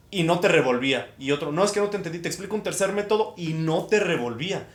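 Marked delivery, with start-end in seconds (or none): none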